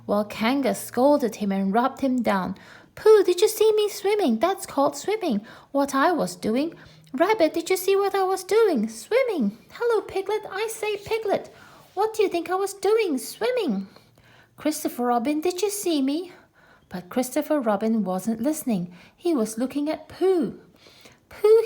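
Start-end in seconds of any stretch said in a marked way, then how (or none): 3.0-16.3 put-on much higher squeaky childlike voice; a tiny show-off telling everyone; high eager child's voice, proud and excited; boastful little Roo. A narrator's reading. 21.3-21.6 much higher, louder sudden call; a sharp surprised call of the name. A narrator's reading. Christopher Robin notices the pole.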